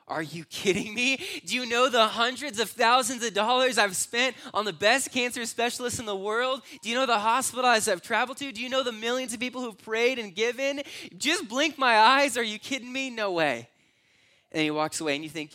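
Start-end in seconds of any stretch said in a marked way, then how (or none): 0.1-10.8 indignant, raised-voice tirade; outraged disbelief. Retold or imitated. Outraged rant at the homeless man. 11.2-13.7 indignant mocking shout; scoffing outrage. Peak of the outraged rant.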